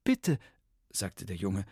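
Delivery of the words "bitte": put-on higher, eager boy's voice, stammering; nervous boy blurting out his trouble. Vampire boy explains to Bertha, nervous and higher.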